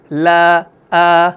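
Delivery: light, open aa in لا، آ; fine long aa on لا; thin alif. Shown as a demonstration. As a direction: hold لا، آ with an unrounded thin vowel. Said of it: "بعد والے الف کی آ".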